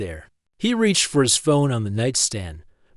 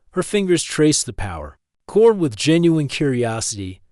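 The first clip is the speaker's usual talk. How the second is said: plain reading voice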